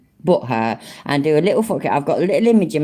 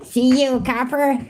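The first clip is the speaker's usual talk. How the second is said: mock American accent; 7 semitones higher than usual.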